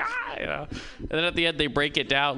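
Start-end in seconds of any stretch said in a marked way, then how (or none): 0.0-0.4 put-on sudden high yelp of an old man in a walker; old man's cry of alarm. Old man in a walker yelps as a snake swings near.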